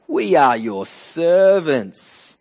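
slow, loud, drawn-out declaration of servitude; mock flattery. Gibeonites answer Joshua with flattery, slow mock-servile declaration. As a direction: stretch it out in showy submission.

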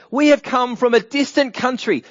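high, loud proclamation from a travelling envoy; acted plea. Gibeonite envoy pleads to Joshua for a treaty, acted plea. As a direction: announce it grandly, pitched well up.

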